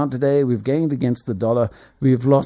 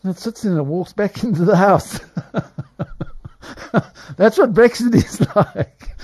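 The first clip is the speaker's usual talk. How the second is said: real laughter breaking through the words; laughing; 9 semitones higher, 4 dB louder than usual. Real, not acted. A commentator cracking up at his own Brexit cat joke, laughing through it. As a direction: laugh at your own joke as you finish it. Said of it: "now I'm laughing at my own jokes".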